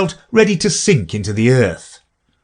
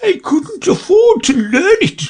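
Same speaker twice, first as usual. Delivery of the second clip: very high, louder, mournful creature voice; sighing Mock Turtle; 3 dB louder than usual. A narrator's reading. Mock Turtle sadly telling Alice what he couldn't afford. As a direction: sigh the regret out. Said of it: "with a sigh".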